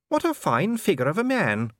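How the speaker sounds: high-voiced lady, weakly polite; a weak attempt at praise, pitched high; faint compliment in a high voice; weak politeness. A narrator's reading. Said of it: "said Sybil weakly".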